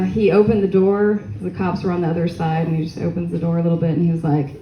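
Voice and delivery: ordinary talk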